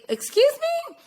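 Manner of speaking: very high, incredulous squeak; mock disbelief. Woman reacting to her date's drink order, mock disbelief. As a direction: shoot the question way up, half laughing.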